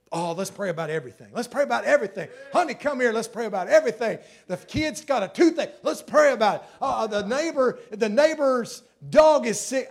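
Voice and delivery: overeager, want to pray about everything; eager new believer. Retold or imitated. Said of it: "You remember how you just".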